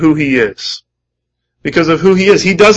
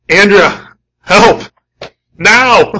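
higher, louder urgent call; urgent plea; 5 semitones higher, 4 dB louder, much slower than usual. Shown as a demonstration. Husband urgently calling his wife for help, loud. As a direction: yell for help right now. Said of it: "that second type of pleading".

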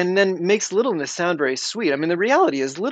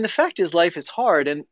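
ordinary delivery

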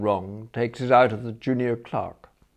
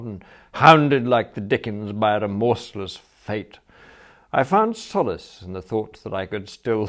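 usual speaking voice

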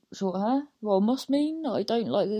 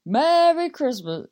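mock festive greeting; 7 semitones higher, 5 dB louder than usual. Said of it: "and then say".

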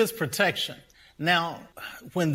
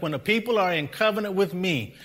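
raised pitch, driving sermon delivery; impassioned preaching; 6 semitones higher, 4 dB louder than usual. Real, not acted. Pastor telling congregation God blessed his people as witness, impassioned preaching. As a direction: preach it with mounting force.

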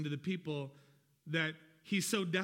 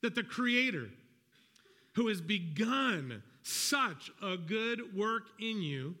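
scripture reading; 2 semitones higher than usual. Shown as a demonstration. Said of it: "here's how it reads".

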